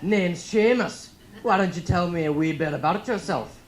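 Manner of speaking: loud, high Scottish interviewer; hammed-up Scottish greeting; Scottish accent. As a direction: boom it out as a hearty Scotsman. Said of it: "the guy interviewing me is Scottish".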